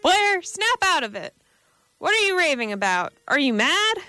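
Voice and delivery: sharp rebuke. Acted. Silvers shaking Blair out of his daze, sharp and urgent. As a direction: snap him out of his trance. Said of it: "Silver's voice rang sharply".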